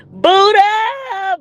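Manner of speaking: high, loud, stretched name; delighted long shout-out; calling out. Real, not acted. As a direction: holler the name in greeting. Calls out arriving viewer.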